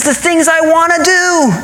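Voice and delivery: high, drawn-out exasperated complaint; frustrated. Acted. Preacher voices Paul at wit's end, frustrated. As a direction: moan it out slowly, pitched high. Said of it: "I feel like he's just frustrated".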